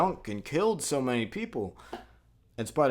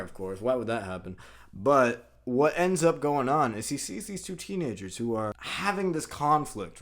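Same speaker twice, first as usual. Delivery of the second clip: normal talking voice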